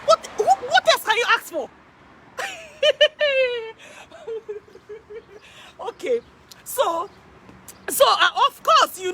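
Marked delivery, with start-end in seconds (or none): 2.8-3.7 short high laugh; amused laugh. Real, not acted. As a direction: laugh at how good it is. Laughs at own question.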